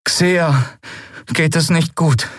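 put-on high, downcast young voice; Miko, deeply troubled. Boy telling captain his friend is unwell, troubled. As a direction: break bad news, weighed down. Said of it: "Miko klang tief bedrückt".

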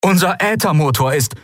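high, urgent captain's voice; distress report. A narrator's reading. Shipwrecked captain telling rescuers of damage, urgent distress report. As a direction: report damage urgently over a bad line.

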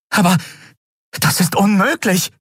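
high-pitched cry of disbelief; exclaiming. A narrator's reading. Woman hears of the captive's destination, exclaiming. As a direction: exclaim it, voice jumping way up. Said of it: "rief sie aus".

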